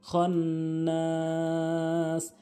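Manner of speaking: খন্নাস slow, with a long hummed n; ghunna. Shown as a demonstration. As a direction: hold the n of খন্নাস, then stretch the vowel. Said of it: "অজীব গুণনা করবেন". (খন্নাস গুন্নাহ করে লম্বা টেনে)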